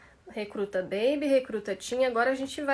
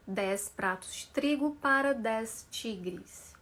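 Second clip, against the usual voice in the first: slow, softer measured syllables; 2 semitones lower, 4 dB quieter, slower than usual. Shown as a demonstration. Teacher repeating the twister slowly for students. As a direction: ease through it gently and slowly. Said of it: "Isso de uma forma devagar".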